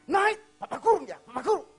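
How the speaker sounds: loud, high angry shout; threatening father. Retold or imitated. Father threatening to lock up his son, angry shout. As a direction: yell the threat at the child.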